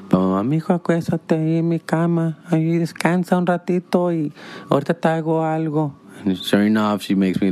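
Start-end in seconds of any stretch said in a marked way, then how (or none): 0.5-5.9 higher, tender motherly voice; doting mom. Retold or imitated. Mom sends her son to rest in her bed, higher and tender. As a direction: coddle your son warmly.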